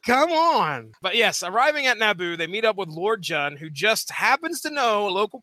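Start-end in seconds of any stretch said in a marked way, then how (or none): 0.0-0.9 higher, slow, drawn-out disbelief; incredulous. Acted. Reader reacting to Lord Jun's backstabber mustache, incredulous. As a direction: scoff at the very idea of trusting him. Mock disbelief at shady character.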